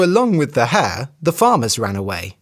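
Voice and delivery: ordinary delivery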